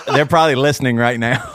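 normal talking voice

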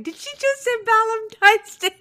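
high, loud incredulous question. Retold or imitated. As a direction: sound stunned by what she said. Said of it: "I was like".

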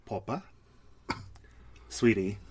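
put-on low British-accented endearment. Podcast host teasing co-host with mock British endearments. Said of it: "my British accent".

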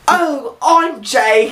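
put-on high-pitched, failing British accent; botched British accent. Actor retelling meeting a British cousin, botched British accent. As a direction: stumble into a shaky attempt at British. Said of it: "I can do a British accent".

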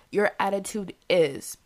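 ordinary talk

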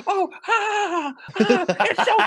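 high, loud sarcastic fake laugh; imitated hysterical, furious ha-ha; Ninja freaking out. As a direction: shriek a mocking laugh at a kid. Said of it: "He's, like, freaking out".

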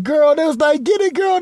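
high squealing cheer; cheering girlfriends. Retold or imitated. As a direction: shriek it like hyped-up girlfriends. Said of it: "She's like".

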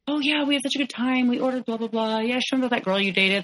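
high, casual gushing retelling; breezy chatter, re-enacted. Girlfriend reports on lunch with his colleague, high breezy chatter.

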